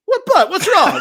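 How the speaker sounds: loud, very high-pitched alarmed owner; hammed-up, high outburst of concern; mock disbelief. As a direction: imitate an owner aghast at a complaint. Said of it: "He couldn't believe it".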